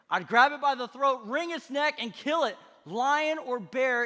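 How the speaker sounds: loud, high, punched-out bragging; fierce boast. Acted. David telling Saul how he killed lions, loud boast. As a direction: boast at full voice, hitting each action.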